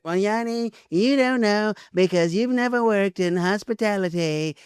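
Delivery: exaggerated imitation of a friend's lecturing; mocking co-host impression. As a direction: tease your co-host by stretching out his stock line. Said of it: "I don't talk like that".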